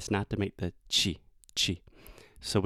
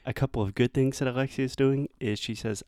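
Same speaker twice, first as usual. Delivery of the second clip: normal talking voice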